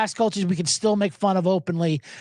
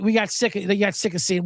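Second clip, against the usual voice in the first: normal talking voice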